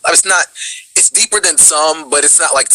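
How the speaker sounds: normal talking voice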